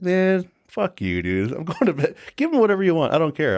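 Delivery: high fed-up sleepy retort; exasperated brush-off. Retold or imitated. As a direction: tell him off and go to bed. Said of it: "I'm like".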